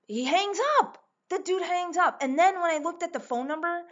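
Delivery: high, loud, incredulous retelling; indignant disbelief. Real, not acted. Storyteller on the driver hanging up, indignant. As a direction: raise the pitch in outraged disbelief.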